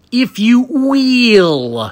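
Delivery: slow drawn-out wrestler promo voice; Dusty Rhodes impression. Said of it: "I'm doing a lot of Dusty Rhodes today".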